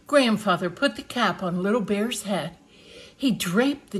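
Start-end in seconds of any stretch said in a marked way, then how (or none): none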